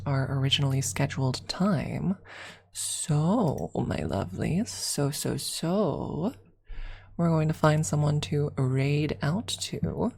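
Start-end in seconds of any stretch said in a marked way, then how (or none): none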